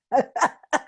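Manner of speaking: loud, high burst of laughter; real, amused belly laugh; hearty laugh. Real, not acted. Host cracking up after teasing her group, hearty laugh.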